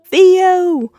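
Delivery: high, very loud holler; imitated kid's yell for a sibling; calling out. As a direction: belt the name across the house. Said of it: "Rudy calls o-, out for Theo".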